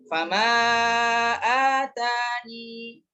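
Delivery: fama atani chanted slowly, ending on a long -ni; stop with ya read. Shown as a demonstration. Teacher demonstrates stopping on fama atani, final ya held long. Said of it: "ketika waqaf ya dibaca".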